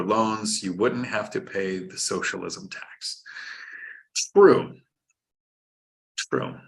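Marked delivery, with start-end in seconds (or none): 4.3-4.7 punchy, louder agreement; loud emphatic. Real, not acted. Emphatic agreement with super chat. 6.2-6.6 softer, murmured echo; quiet. Real, not acted. Repeated agreement, trailing off.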